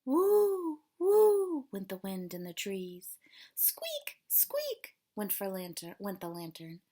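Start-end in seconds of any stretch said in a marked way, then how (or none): 0.1-1.7 high, loud wind howl woo; ghostly wooing wind, pitched up high; wind noise. Acted. Wind howling in story. 3.6-4.2 squeak. Acted. Lantern squeaking in story. 4.3-4.9 squeak. Acted. Lantern squeaking in story.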